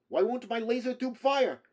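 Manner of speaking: put-on high, loud Slavic-accented yell; furious shout. A narrator's reading. Android finds his weapon will not fire, furious shout.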